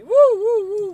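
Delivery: high, loud woo woo woo; positive-energy whoops. Real, not acted. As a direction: pump up the good vibes.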